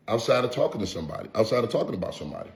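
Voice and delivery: quick and soft, tossed off; fast, quiet aside; fast mutter. Real, not acted. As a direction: rattle it off under your breath.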